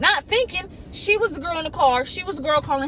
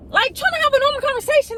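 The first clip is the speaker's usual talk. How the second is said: much higher, fast and louder exasperation; outraged squeak; indignant; 12 semitones higher, 6 dB louder, faster than usual. Retold or imitated. Indignant about his call.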